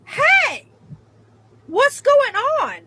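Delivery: high, loud startled hey; retold call-out. Retelling her startled shout at the woman next door, high and loud. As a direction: call out to the stall neighbour. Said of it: "And I said".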